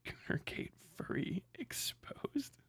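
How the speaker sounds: slow, breathless whisper; hushed stunned whisper. Real, not acted. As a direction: whisper the headline in disbelief. Reading headline in disbelief.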